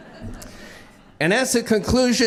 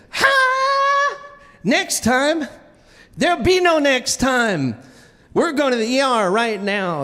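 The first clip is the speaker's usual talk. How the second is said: mock furious wife; 6 semitones higher than usual. Wife angrily refuses.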